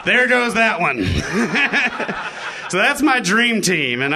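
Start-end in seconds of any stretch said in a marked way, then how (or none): 0.0-1.1 laughing. Real, not acted. Laughing punchline.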